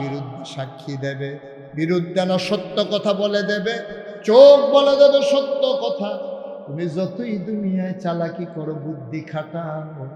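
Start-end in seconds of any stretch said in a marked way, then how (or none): 4.2-6.2 loud, higher slow preach; preaching climax. Acted. Preacher driving home the point, loud and slow.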